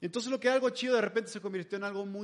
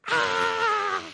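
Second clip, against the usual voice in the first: put-on a sudden, much higher, louder toddler shriek; imitated child's scream; 8 dB louder than usual. Dad imitating his drenched daughter bursting into a shrieking cry. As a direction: let out the little girl's soaked wail. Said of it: "empieza a gritar y a llorar".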